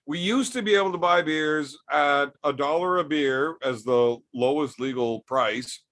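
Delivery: ordinary talk, nothing marked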